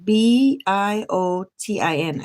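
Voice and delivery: slowly spelled letter by letter. Shown as a demonstration. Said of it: "biotin is a vitamin".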